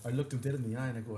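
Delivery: ordinary talk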